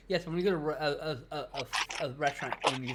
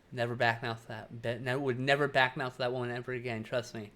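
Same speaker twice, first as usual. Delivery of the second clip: usual speaking voice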